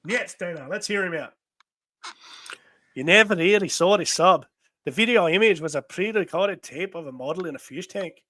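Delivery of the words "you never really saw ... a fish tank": put-on lilting Scottish accent. Comic character explaining the fake sub, Scottish accent. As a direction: keep the Scottish brogue going.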